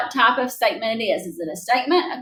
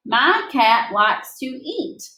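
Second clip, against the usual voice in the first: read slowly as an example; much slower than usual. Shown as a demonstration.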